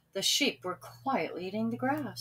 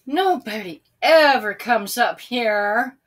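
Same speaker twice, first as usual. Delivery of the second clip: bored whiny moan, louder; 9 dB louder than usual. A narrator's reading. Bored Sam moaning about the empty hill. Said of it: "moaned Sam".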